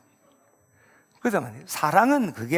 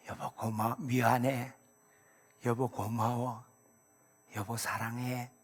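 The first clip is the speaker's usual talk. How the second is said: 다정한 고백; 4 semitones lower, 11 dB quieter, slower than usual. Acted. Voicing spouse's tender thanks.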